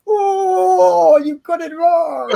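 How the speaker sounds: long, high, loud howl of protest; mock outraged wail. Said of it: "And I'm like".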